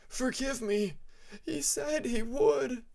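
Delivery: high, slow, tearful begging; sobbing plea. Acted. Nightwing begs the Rainwing king's forgiveness, tearful and high. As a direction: plead for forgiveness, nearly in tears. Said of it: "the Nightwing sobbed".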